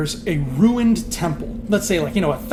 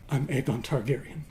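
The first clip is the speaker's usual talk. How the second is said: soft, lowered, beaten admission; utterly defeated; 3 semitones lower, 7 dB quieter than usual. Acted. Defeated Jon gives in to the queen, soft and beaten. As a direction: say it quietly, as if barely managing it. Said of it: "through trembling lips".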